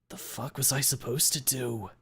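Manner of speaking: growl. Acted. Reed growling back at the narrator, lightly voiced. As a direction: growl it through half-bared teeth. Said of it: "he growls".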